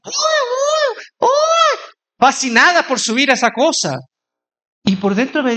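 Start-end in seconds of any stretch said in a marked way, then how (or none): 0.0-1.9 very high mock squeals of delight; excited squeals. Fascinated teenage girls queuing for the ride, high excited squeals. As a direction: imitate thrilled girls squealing. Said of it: "que estaban fascinadas, felices, así".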